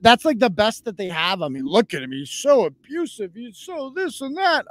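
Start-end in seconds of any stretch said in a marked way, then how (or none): 1.7-4.7 mocking imitation. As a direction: parody whining accusers, dragging it out. Mimics critics' accusations of abuse.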